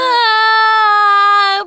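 very high, loud, long howl; joined-in wail. A narrator's reading. Servant joining the princess's crying, howling wail. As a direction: take up the bawling at full pitch. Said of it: "подхватила Фёкла".

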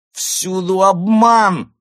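very high, loud, drawn-out theatrical wail; anguished outcry. Acted. Drunk actress lamenting deceit to the cat, anguished outcry. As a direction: cry out in tragic diva despair. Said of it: "вскрикнула Изольда с надрывом".

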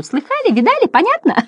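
very high excited squeak; clown butterflies shouting. A narrator's reading. Butterflies to their neighbours, very high excited cries. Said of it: "крича в ухо соседу".

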